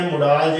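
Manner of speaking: ਮੁਡਾਲ said mu-DAAL; wrong pronunciation. Shown as a demonstration. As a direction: say ਮੁਡਾਲ with short 'u', long 'aa'. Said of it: "ਬੋਲਦੇ ਤਾਂ ਇਹ ਗਲਤ ਹੈ". (ਮੁਡਾਲ, ਛੋਟਾ 'ਉ' ਤੇ ਲੰਮਾ 'ਆ', ਗਲਤ ਉਚਾਰਨ)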